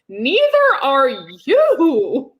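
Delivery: loud, high, drawn-out mock retort; retold indignant comeback. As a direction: throw it back slowly, high and loud. Retold comeback to neighbor's jab.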